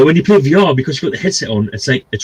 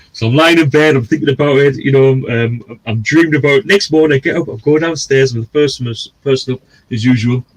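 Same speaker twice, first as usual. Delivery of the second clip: usual speaking voice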